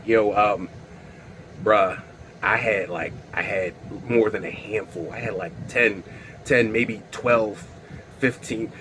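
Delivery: low, soft, guilty admission; sheepish confession. Retold or imitated. Admitting to his friend how many he ate, sheepish. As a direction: mumble it like a kid caught out.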